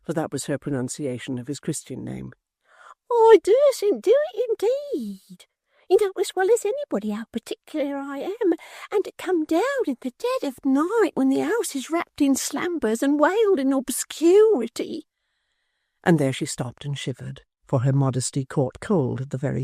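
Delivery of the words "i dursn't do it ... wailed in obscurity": prim Miggs. Acted. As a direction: shrink from the idea, prudishly. Miggs refuses, prim and whispering.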